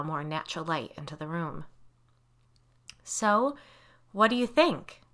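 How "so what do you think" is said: higher, louder, overly upbeat question; put-on brightness, pitched up; forced cheer. A narrator's reading. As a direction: lay on the cheer when asking. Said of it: "laying on the forced cheer".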